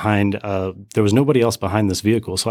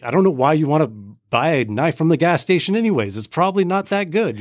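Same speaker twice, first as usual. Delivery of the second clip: higher, pointed jab for the room to hear; snide remark meant to be overheard; 6 semitones higher than usual. Retold or imitated. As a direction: make sure the guy ahead hears it. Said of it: "I say things out loud. I want people to hear".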